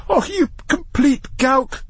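very high, slow exasperated insult; expostulating. A narrator's reading. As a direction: draw it out in utter exasperation. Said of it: "expostulated Matthew".

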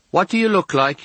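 put-on high-pitched girl calling out; girl's voice. A narrator's reading. Girl calling to unseen patient next door, high girlish voice. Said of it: "a girl's voice says".

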